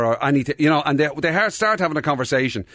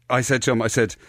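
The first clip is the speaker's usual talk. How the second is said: ordinary talk, nothing marked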